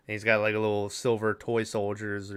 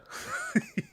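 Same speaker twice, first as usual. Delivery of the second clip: breathy laugh; 9 dB quieter than usual. Real, not acted. Laughs at own punchline.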